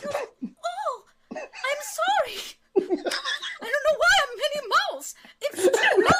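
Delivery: high, half-laughing mock sob; a noblewoman wailing her regret, hammed up; tearful lady. Bride voiced, tearful, high.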